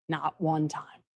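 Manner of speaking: one said low and quick; muttered aside. Shown as a demonstration. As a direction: drop it under the breath. Emphatic repetition of the point.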